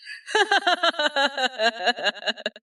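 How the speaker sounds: high rising laugh; gleeful cackle. Acted. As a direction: laugh at the punchline. Laughing at own insult.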